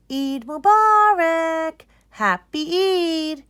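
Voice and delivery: high, loud and slow joyful cheer; excited festive greeting. A narrator's reading. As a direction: call it out with holiday joy. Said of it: "are very excited".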